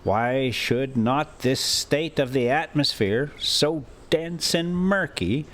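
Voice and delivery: usual speaking voice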